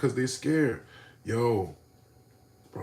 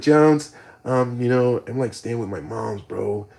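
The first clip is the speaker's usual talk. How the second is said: lightly voiced nerdy guy; nerd guy voice; 4 dB louder, slower than usual. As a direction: sound timid and nerdy. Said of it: "Then it turns into nerd guy".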